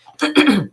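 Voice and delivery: rough throat clear. Real, not acted. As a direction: clear a tired, hoarse throat. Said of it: "I'm losing my voice".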